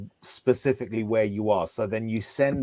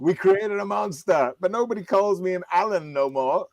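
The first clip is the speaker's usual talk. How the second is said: [name] quote; 7 semitones higher than usual. Pretend. Joking to friends in a mock [name] voice. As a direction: parody a rap line in a put-on voice.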